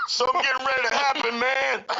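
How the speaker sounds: high, fast, giggling burst of glee; a high laughing cry of delight; excited laughing exclamation. Real, not acted. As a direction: squeal it out between laughs, thrilled. Excited reaction to eerie story.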